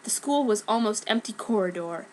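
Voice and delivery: ordinary delivery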